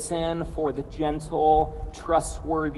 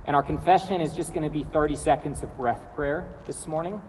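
normal talking voice

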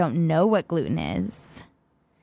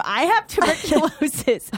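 mock scream; 8 semitones higher than usual. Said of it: "get out of your car and scream".

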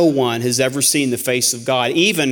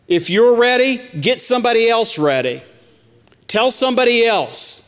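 raised preaching cry; 8 semitones higher than usual. Acted. Preacher urges church to witness.

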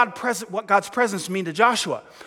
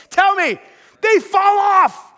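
high, loud, slow proclamation; preaching shout; 9 semitones higher, 4 dB louder, slower than usual. Real, not acted. Preacher shouting the climax to the congregation, loud and slow. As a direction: shout the answer out in triumph.

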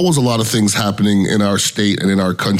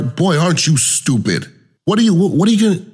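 exasperated, higher and faster; 7 semitones higher than usual. Real, not acted. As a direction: scoff at the fools, speeding up. Mocking dumb drivers, rising pitch.

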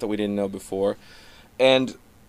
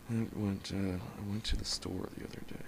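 much softer, nearly murmured; very quiet; 14 dB quieter than usual. Retold or imitated. Comic imitating Stephen Wright's quiet opener, very soft. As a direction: go very quiet to draw them in. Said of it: "went the other way".

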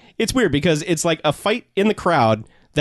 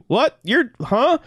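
shocked disbelief; 10 semitones higher, much slower than usual. Retold or imitated. Thug hears she's a cop, higher shocked yelp. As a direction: yelp it out, caught completely off guard. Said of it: "And he's just like".

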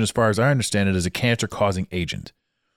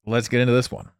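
usual speaking voice

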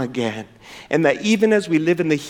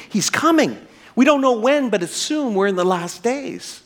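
eager, expectant announcement that eases off; joyful anticipation; 7 semitones higher than usual. Acted. Preacher voicing hope in the second coming, joyful anticipation. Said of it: "we should have a joy and anticipation".

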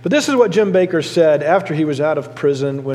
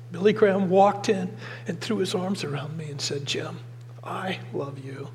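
much softer, barely voiced retelling; hushed, near tears; 9 dB quieter than usual. Pastor quietly retelling Billy Graham's words, hushed. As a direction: let the voice drop away to almost nothing.

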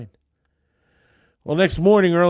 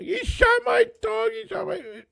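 upset accusation. Retold or imitated. Woman complaining to the police.

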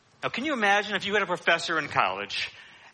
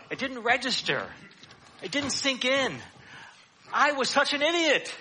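higher, mock-exasperated looking back at yourself; rueful self-reproach; 7 semitones higher than usual. Someone looking back years later, scolding himself with rising pitch. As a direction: kick yourself for missing it, pitched up. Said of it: "and you say".

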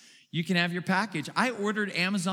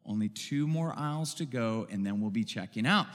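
softer, lower soothing mother; soothing, gentle; 6 semitones lower, 5 dB quieter than usual. A narrator's reading. Mother soothing her crying daughter in the candy aisle, gentle voice. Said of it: "in a soothing, gentle voice".

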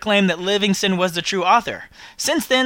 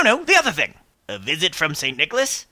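normal talking voice

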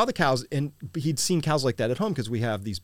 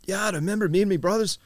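higher, put-on Irish brogue; thick Irish accent; 8 semitones higher, faster than usual. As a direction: imitate an older Irishman reminiscing. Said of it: "had this thick Irish accent".

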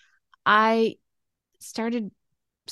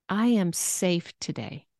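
"safe" lengthened and raised; stress on SAFE. Shown as a demonstration. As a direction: lean on "safe" in the phrase. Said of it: "how it sounds with word emphasis".

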